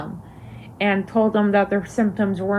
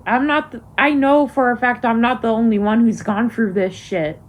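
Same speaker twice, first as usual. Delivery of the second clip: ordinary talk, nothing marked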